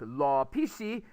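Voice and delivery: "law pishi" for raw fish, L for R and P for F; Konglish pronunciation. Shown as a demonstration. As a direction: say "law pishi" with a Korean final ee. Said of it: "when you Konglish something like raw fish".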